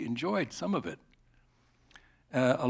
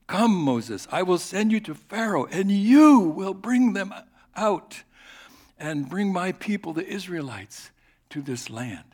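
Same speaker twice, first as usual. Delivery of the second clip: commanding God; 6 semitones higher, 6 dB louder than usual. Acted. Preacher voicing God's call.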